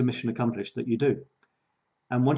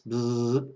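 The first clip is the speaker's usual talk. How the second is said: dull, steady buzzing noise; small monotone "bzzz"; low-key buzz. Shown as a demonstration. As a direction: buzz along at an even level. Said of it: "Sort of like this".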